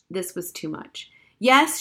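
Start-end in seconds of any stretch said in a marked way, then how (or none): 1.4-1.8 loud, high indignant yes; shouted. Acted. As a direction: blurt it out in outrage.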